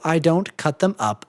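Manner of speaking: usual speaking voice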